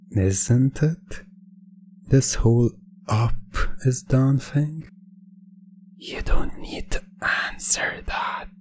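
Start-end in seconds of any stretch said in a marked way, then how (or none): 6.0-8.5 whisper. Acted. Hypnotist whispering to the dazed listener. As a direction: breathe it as a private aside.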